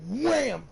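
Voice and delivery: sudden loud, high "wham"; imitated punch impact. Telling how his friend dropped the bully, sudden loud impact noise. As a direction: punch the sound out like a hit.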